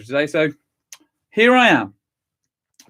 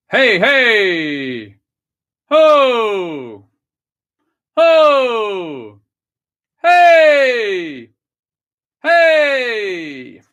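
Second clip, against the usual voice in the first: higher, louder "Hey" and "Ho" barked from the belly; loud calls; 6 semitones higher, 7 dB louder, much slower than usual. Shown as a demonstration. Choir warm-up, leader shouts breath calls. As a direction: punch each call out. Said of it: "Now you".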